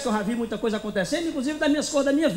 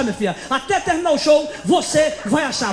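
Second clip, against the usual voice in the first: ordinary talk, nothing marked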